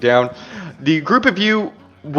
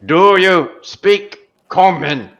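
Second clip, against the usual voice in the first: louder dwarf; 6 semitones higher, much slower than usual. Acted. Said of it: "says a little bit louder".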